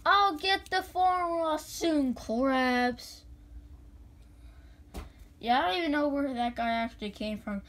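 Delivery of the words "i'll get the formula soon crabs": scheming cartoon villain, slow and higher; Plankton voice. Caller repeats the guy's cartoon villain voice to the dispatcher. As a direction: plot like a tiny cartoon villain. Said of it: "what voice did that guy do".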